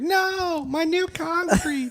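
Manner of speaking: high falsetto cry; mock distressed wail. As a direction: wail it in a squeaky panic. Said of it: "Jerry's, like".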